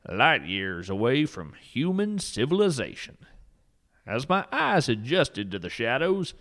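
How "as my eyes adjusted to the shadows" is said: usual speaking voice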